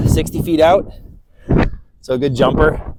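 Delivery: winded after climbing a hill; breathless, puffing between words; out of breath. Real, not acted. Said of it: "Sorry, I'm out of breath".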